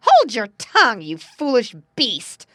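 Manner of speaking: loud, high-pitched telling-off; angry scolding. A narrator's reading. Stepmother to the little dog, loud scolding. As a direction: scold the dog at full volume. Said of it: "scolded the woman".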